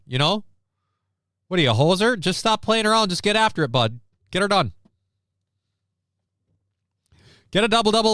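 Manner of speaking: mock Canadian hoser telling him to get after it, bud; Canadian accent. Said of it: "I don't have enough Canada accent".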